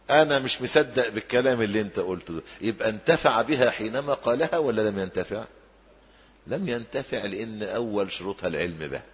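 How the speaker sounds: ordinary talk, nothing marked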